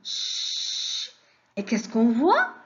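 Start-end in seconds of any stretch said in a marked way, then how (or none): 0.0-1.1 "ch" as a long voiceless shhh; isolated ch sound. Shown as a demonstration. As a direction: hold "ch" with no vowel. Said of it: "On entend le son". (« ch » prolongé, sans voyelle)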